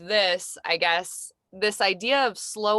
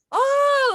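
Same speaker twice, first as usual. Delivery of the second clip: exasperated oh; 8 dB louder than usual. Retold or imitated. Exasperated at van mess.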